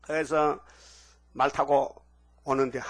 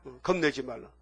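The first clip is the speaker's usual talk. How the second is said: ordinary talk, nothing marked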